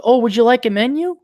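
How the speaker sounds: imitated waitress. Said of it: "some gal comes off to my left".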